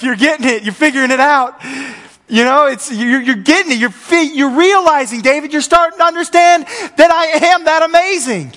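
building to a high, loud, exultant shout; God's excited cheering. Acted. God to David as he starts getting it, excited shouting.

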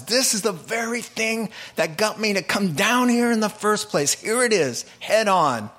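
a hint of Jesus seeing it clearly; Jesus' inner thought.

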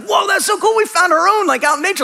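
high-pitched, delighted amazement; excited discovery. Retold or imitated. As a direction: relive the thrill of a lucky find. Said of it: "We're all excited".

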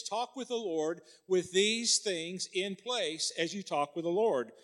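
ordinary delivery

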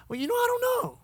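high, soft, wavering uncertainty; mock unsure man. Preacher mimics man at the mirror, mock unsure. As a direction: say it small and high, as a mocking impersonation.

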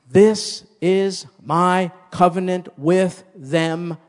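each word drawn out and loud; preacher reading scripture with weight; slow loud proclamation. Acted. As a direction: proclaim the covenant slowly. Scripture quoted loudly slowly.

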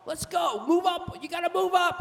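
high, loud mock yell of an impatient driver; imitated angry yelling. Woman honking at the man ahead, mock angry yelling. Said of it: "she's yelling".

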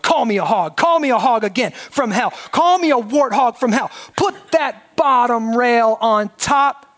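defiant mild shouting, ramping up; building yell. Acted. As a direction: hurl each call at the sky. Ruby yelling at God.

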